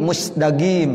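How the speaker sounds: Egyptian "musdagim", hard g for qaf; Egyptian dialect. Shown as a demonstration. Teacher imitating Egyptians, Egyptian-dialect "musdagim". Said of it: "dialek orang Mesir".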